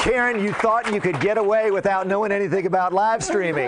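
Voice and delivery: teasing through a laugh. Real, not acted. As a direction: rib her while laughing.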